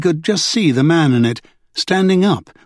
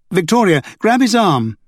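urgent high call; pitched-up rescue command; 6 semitones higher than usual. A narrator's reading. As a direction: order her to grab him.

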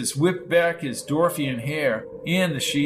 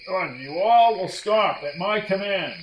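yelling commander; 4 semitones higher than usual. A narrator's reading. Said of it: "Brady could hear Grok yelling".

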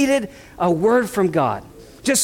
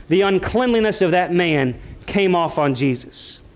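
ordinary talk, nothing marked

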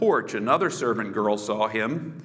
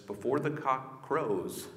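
quiet prophecy; 3 semitones lower, 7 dB quieter than usual. Acted. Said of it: "And Jesus says".

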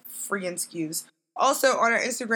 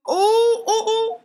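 excited squeal; 13 semitones higher, 6 dB louder, much slower than usual. Real, not acted. Squealing after boats.

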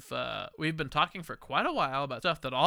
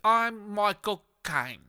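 put-on slow, light Cockney Michael Caine accent; too much Cockney, not enough Caine; Michael Caine impression; 5 semitones higher, slower than usual. A drunk podcaster names himself in a mock Cockney Michael Caine accent. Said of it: "you're doing more Cockney than his actual".

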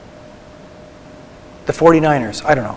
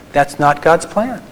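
ordinary talk

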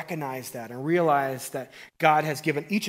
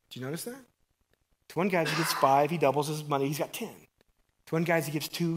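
normal talking voice

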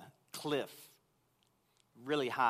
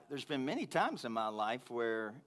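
ordinary talk

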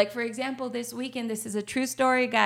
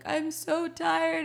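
higher, slower, worn-out plea; replaying a near-breakdown, drawn out; retold exhaustion; 9 semitones higher, slower than usual. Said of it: "I kind of broke down a little".

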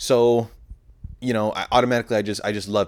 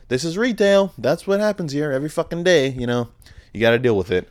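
knowing aside; 6 semitones higher than usual. Retold or imitated. Tells customers retail is like this.

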